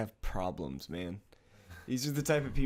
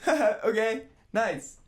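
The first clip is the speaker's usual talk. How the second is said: put-on girlfriend's nervous laugh; 12 semitones higher, 7 dB louder than usual. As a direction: imitate a nervous giggling girlfriend. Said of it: "she's just like".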